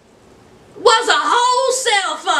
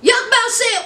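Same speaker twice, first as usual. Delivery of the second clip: ordinary talk, nothing marked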